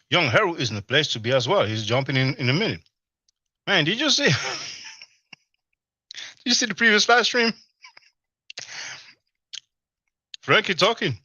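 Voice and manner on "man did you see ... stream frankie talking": talking through a mouthful of food; chewing while talking excitedly; mouth full. Real, not acted. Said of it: "not supposed to talk with your mouth full".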